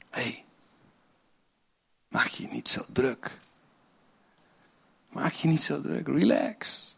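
put-on calm a worried child, softly; gentle reassurance. God's gentle answer voiced.

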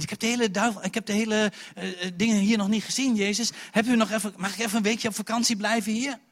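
lightly acted loud, high plea; pleading Judas. Imagined Judas asking Jesus for a holiday, eager plea.

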